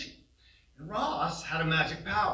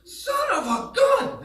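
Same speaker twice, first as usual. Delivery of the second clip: much higher, loud, slow thrilled shout; excited yelling; 11 dB louder, slower than usual. Retold or imitated. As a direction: whoop like you just hooked a whale. Brother hooks a huge fish.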